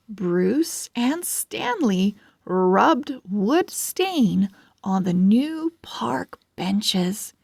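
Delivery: ordinary delivery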